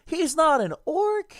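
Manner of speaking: high, loud, slow exclamation; loud surprised outburst. Real, not acted. Streamer reacting to the book character, loud high outburst. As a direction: blurt it out high and loud in disbelief.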